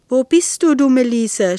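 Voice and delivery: exasperated yell; angry shout. A narrator's reading. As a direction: shout for someone who has gone missing. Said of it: "schrie er".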